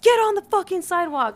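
high, loud mock cop shout; imitated yelled command; retold yelling. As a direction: bark it like police giving an order. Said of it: "they're still yelling".

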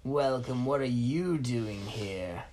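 put-on villain greeting the trapped boy, slow and loud; lightly voiced villain. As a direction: welcome the captive with menace.

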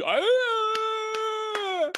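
high loud crowd gasp; mock crowd gasp. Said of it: "the sound I heard during".

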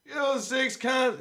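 put-on drunk Irish fella. Drunk Irish fella demanding cans in a library, slow and loud. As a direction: drag out a drunk request for cans. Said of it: "went into the library and said".

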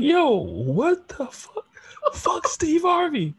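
high, slow incredulous yo; mock disbelief, drawn out; confused outrage at a meme; baffled. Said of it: "And I'll be like".

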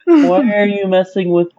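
voicing Francis. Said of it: "and be like".

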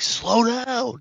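high, reenacted warning yell; re-create a protective dad's yell. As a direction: yell it out like a dad at a speeding car. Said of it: "and I yelled".

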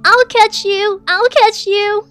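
put-on high, loud, excited child calling. A narrator's reading. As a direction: chase it, squealing with glee. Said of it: "she excitedly called".

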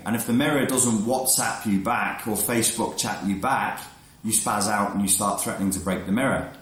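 ordinary talk, nothing marked

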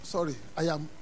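ordinary talk, nothing marked

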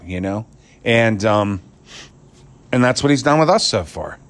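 usual speaking voice

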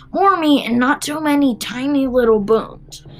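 a touch of gloating menace; lightly voiced villain relishing his threat; horrible grinning villain. A narrator's reading. Mister Twit plans his boy pie, mildly gloating villain. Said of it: "grinning horribly".

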